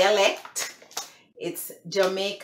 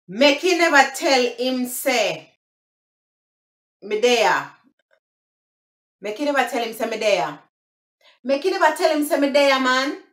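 higher, lively Jamaican patois; 5 semitones higher than usual. Shown as a demonstration. Vlogger demonstrating phrase number four, Jamaican patois. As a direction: deliver it emphatically in Jamaican patois. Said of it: "that's the broken English".

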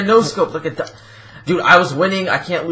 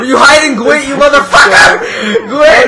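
loud, higher-pitched outraged shout; angry accusation; 5 semitones higher, 5 dB louder, slower than usual. Real, not acted. Player accusing Gwent of hiding, angry shout. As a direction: yell at the culprit in real outrage.

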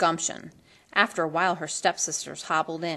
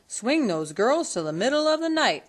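lightly voiced Joe calling; 6 semitones higher, 3 dB louder than usual. A narrator's reading. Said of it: "Joe called back".

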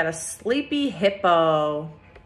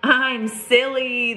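lifted, sing-song "Look at me"; picture book read-aloud; storybook refrain; 3 semitones higher, much slower than usual. A narrator's reading. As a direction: read the refrain brightly for kids.

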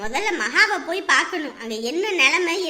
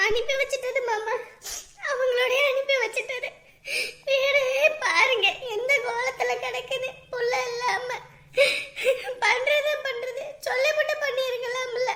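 higher-pitched tearful lament; crying; 4 semitones higher than usual. Acted. Mother tells uncle her daughter was sent away, crying. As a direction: sob between the phrases. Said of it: "இப்ப எதுக்கு அழுவுற".

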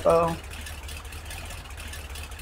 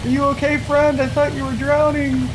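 high, squeaky put-on voice of fake concern; mock concerned friend; 10 semitones higher than usual. Friend teasing buddy who nearly drowned, high mock concern. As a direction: pitch it way up, teasing worry.

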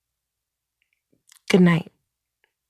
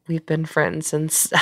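usual speaking voice

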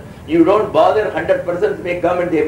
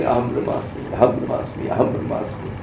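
softly murmured, lower-pitched chant to oneself; hushed mantra repetition; 5 semitones lower, 6 dB quieter than usual. Retold or imitated. Janaka sitting alone by the Ganges telling his mantra, hushed. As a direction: repeat it under the breath, over and over.

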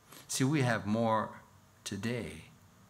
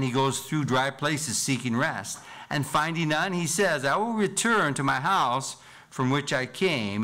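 raised, higher reading of the Bible passage; louder preached scripture reading; 5 semitones higher, 6 dB louder than usual. Acted. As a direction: proclaim the verses to the congregation.

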